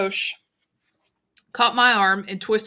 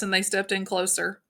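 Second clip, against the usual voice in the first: ordinary delivery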